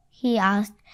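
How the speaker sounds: plain reading voice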